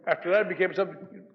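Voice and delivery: normal talking voice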